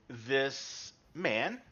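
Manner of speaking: ordinary talk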